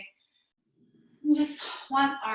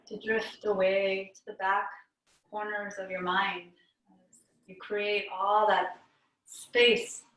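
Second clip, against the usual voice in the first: calm meditation teacher with long pauses; guided meditation; 2 semitones lower, 4 dB quieter than usual. Real, not acted. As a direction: guide them inward, unhurried, leaving long silences between phrases.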